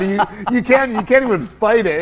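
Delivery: ordinary delivery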